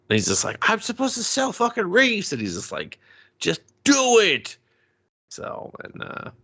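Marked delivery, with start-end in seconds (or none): 0.7-2.3 high whiny complaint; whiny kid protest. Retold or imitated. Kid whining at his dad. 3.4-4.6 high, slow curt order; mock stern dad. Dad ordering his son.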